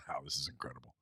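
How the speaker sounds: hushed mock awe; whispered awe. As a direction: breathe it out in wonder. Imagined reaction at Starbucks.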